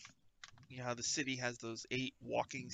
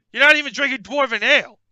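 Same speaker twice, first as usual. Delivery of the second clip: high, fast furious yell; furious dwarf shout; 11 semitones higher, 19 dB louder, much faster than usual. Acted. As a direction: explode at him in outrage. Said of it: "If you get really angry here".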